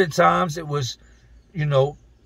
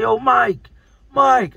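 raised, higher-pitched call up to a window; half-yelled call, pitched well up; yelling up; 9 semitones higher, 5 dB louder, much slower than usual. Retold or imitated. Inmate hollering up to his friend's top-floor window, yelling. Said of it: "I'm yelling up to his building".

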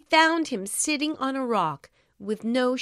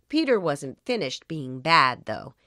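usual speaking voice

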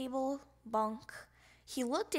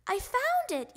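high, loud discovery shout; excited little frog's shout; triumphant yell; 11 semitones higher, 6 dB louder than usual. A narrator's reading. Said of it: "yelled Froggy".

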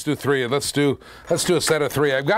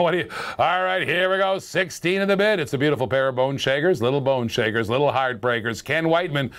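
normal talking voice

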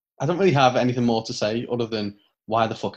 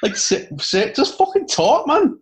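exasperated, high-pitched Geordie lilt; slipping into Geordie; 12 semitones higher, 4 dB louder, slower than usual. Real, not acted. Fed up with a wrestler's slow promo, near-Geordie exasperation. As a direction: let frustration tip you into Geordie. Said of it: "you almost went Geordie then".

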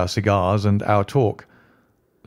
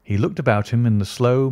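usual speaking voice

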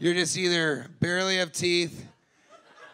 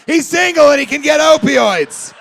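higher, louder proud-mom announcement; loud mom bragging; 8 semitones higher, 13 dB louder than usual. Retold or imitated. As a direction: sell her son to the room like a proud mother. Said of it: "their mom goes".

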